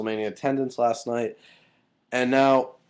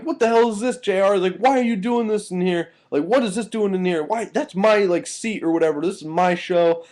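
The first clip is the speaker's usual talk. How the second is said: high-pitched imitated outrage; hammed-up protest in a raised, higher voice; mock indignation; 9 semitones higher, 4 dB louder than usual. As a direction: mimic an indignant complainer, pitched well up. Acting out an indignant complaint.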